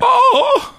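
very high, drawn-out "Oh!"; high-pitched mock-excited cry; exclaimed "Oh!". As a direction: whoop out a high comic "Oh!". Reacts to Danny Dyer being named.

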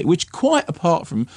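normal talking voice

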